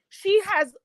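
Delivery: ordinary talk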